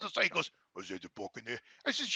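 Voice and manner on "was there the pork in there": very low, very soft murmur; hushed, deep imitation of a friend; retold cautious question. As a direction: imitate a man asking discreetly. Voicing Alex asking about pork.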